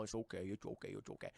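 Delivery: low, soft soothing murmur; soft low reassurance; soft comforting. Retold or imitated. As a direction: hush him gently. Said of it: "Lois comforting".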